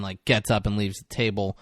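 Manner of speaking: ordinary talk, nothing marked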